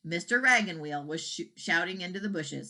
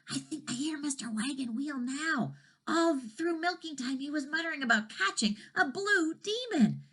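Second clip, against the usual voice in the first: higher hushed cow voice; 9 semitones higher than usual. A narrator's reading. Cow warning the dragon, higher hushed voice. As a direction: warn them like a nervous cow.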